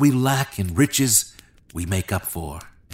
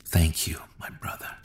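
whispered, moved to tears; whispered thanks; 2 semitones lower, 7 dB quieter than usual. A narrator's reading. David thanking Jonathan through tears, whispering. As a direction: breathe the thanks, barely voiced. Tearful thanks after encouragement.

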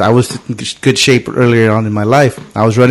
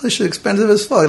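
indignant; 9 semitones higher, 3 dB quieter than usual. Retold or imitated. Indignant answer to cop's order.

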